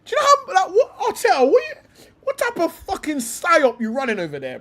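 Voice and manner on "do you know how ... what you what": incredulous. Real, not acted. Incredulous rant at Arteta.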